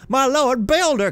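high, loud cry of awe. Acted. Rich novice to the revealed builder, crying out. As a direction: cry out to your lord. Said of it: "cried the rich novice".

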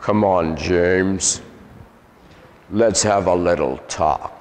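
low, measured demon voice; deep man's voice. Retold or imitated. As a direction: imitate a demon speaking deep, coaxing and unhurried. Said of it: "a deep man's voice. And he said".

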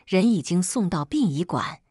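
plain reading voice

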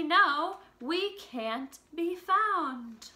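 slow, sing-song storybook reading; reading to children. Acted. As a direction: read the lyrics gently to little kids. Reads song lyrics to children.

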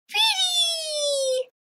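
put-on high delighted child squeal; little girl voice. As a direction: squeal it like a toddler. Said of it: "Emma blinked and said".

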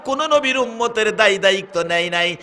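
soaring sermon proclamation, easing down; full-force preaching. Real, not acted. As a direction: start high and loud, settle gradually.

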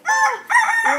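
imitating mom's high shriek of fright; a sudden mock scream, way up high; freaked-out scream. Mom freaking out at fake poo, shrieking. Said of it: "she's gonna freak out and be like".

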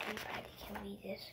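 quick unvoiced whisper; fast hushed aside. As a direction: hurry it out in a breathy secret. Repeats line as a whisper.

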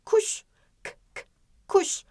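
"Kuş", short separate "k" sounds, then "kuş"; isolated first sound. Shown as a demonstration. Teacher testing the first sound, isolated "k" before "kuş". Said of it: "Yine O sesiyle başlamıyor".

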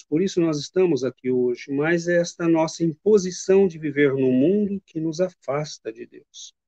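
normal talking voice

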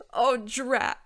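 exasperated allergic dragon. A narrator's reading. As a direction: blurt a mild curse after sneezing. Sneeze burns the handkerchief.

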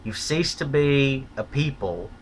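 ordinary talk

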